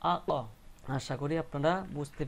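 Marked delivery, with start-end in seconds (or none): 0.1-0.3 আ, ক with a slight push releasing the ক; qalqalah push. Shown as a demonstration. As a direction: push the ক out after আ so it bounces. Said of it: "একটু ধাক্কা দিয়ে উচ্চারণ করতে হবে". (একটু ধাক্কা দিয়ে আ, ক)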